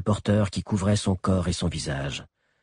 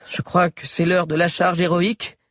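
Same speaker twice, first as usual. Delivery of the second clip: high, raised character voice; announcing; 9 semitones higher than usual. A narrator's reading. Agnel announces the heroic charge, high raised voice. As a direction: announce it with pitch lifted. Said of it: "annonça Agnel".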